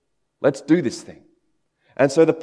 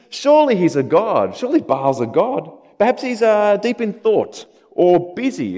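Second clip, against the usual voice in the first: higher-pitched, patronizing taunt; raised-pitch mock concern; 7 semitones higher, 3 dB louder than usual. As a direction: taunt them with feigned helpful suggestions. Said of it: "Elijah starts mocking them".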